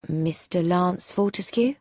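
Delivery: usual speaking voice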